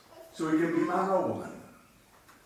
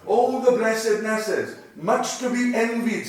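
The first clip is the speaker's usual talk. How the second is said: exclamation; 6 semitones higher, 6 dB louder than usual. Shown as a demonstration. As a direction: cry it out in admiration. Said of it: "looking on".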